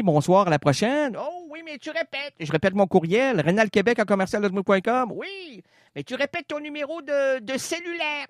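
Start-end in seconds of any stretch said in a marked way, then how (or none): 1.2-2.3 soft high inner voice. Acted. Inner voice accusing. 5.2-8.3 soft high inner voice. Acted. Inner voice accusing.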